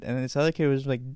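ordinary delivery

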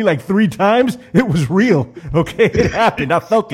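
worked-up, emphatic protest; emphatic insistence. Acted. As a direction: insist on it, punching each short phrase. Insisting against the other's denial.

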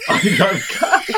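giggling while speaking; laughing. Real, not acted. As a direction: squeeze the words out through giggles. Cracking up at a joke.

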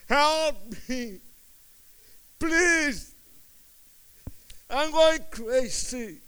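loud, high desperate scream; screaming for help. Retold or imitated. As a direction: cry out for help at the top of your voice. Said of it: "I started screaming".

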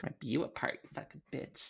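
whispered curse under the breath. Acted. Henchman curses his queen under his breath. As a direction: mutter it so the queen won't hear. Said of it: "What did you say".

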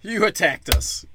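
villain faking total surprise; mock shock. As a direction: play up sarcastic astonishment. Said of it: "he comes back and he's like".

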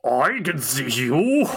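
a dead head mocking him slowly; creepy head. Severed head taunts Xander.

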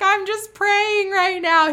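high, loud, slow embarrassed self-quote; flustered reply. Retold or imitated. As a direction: blurt it out, caught mid-prayer and flustered. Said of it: "I'm like".

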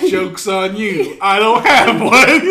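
slow, loud drawn-out comeback; mock smug. Recruit answering girlfriend insult, mock smugness. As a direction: gloat slowly, building into laughter.

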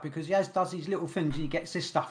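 ordinary talk, nothing marked

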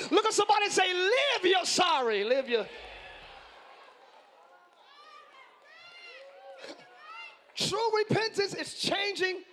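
loud, high, driving sermon; preaching at full force. Acted. Preacher driving home true repentance, loud and fervent. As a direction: hammer the point home to the church.

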